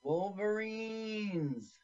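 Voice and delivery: slow, high stretched call; drawn-out movie cry. Pretend. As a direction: moan the name out slowly. Repeating Red Dawn cry.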